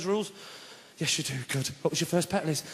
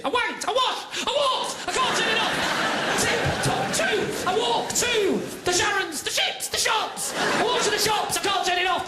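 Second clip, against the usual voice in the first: high-pitched compulsive blurting, words stretched; mock Tourette's-like outburst; uncontrollable word outbursts; 9 semitones higher, slower than usual. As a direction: blurt each word helplessly, high and urgent. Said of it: "predictive talking that people can't turn off".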